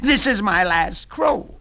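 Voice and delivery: very high cock-a-doodle crow, then slow proud words; rooster crow. Acted. Rooster crowing his last before the soup.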